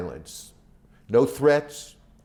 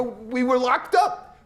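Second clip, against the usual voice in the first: high, slow mock exasperation; incredulous protest; 14 semitones higher, slower than usual. Filmmaker protesting to the warden, high and incredulous. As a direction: plead your innocence in disbelief. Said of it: "I go".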